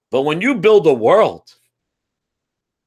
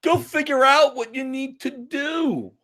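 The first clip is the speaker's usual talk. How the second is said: mock game voice; 7 semitones higher than usual. Mocking the game's instructions.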